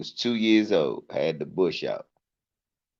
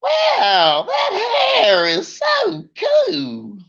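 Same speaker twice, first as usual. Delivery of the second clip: much higher, slow, squealing lady voice; gushing lady; much slower than usual. Retold or imitated. Comic mimicking a lady gushing over a boy's hair. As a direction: drag out the fake awe.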